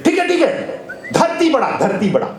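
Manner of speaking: high-pitched, placating priest; acted cornered surrender; hurried concession. Cornered priest gives in hastily.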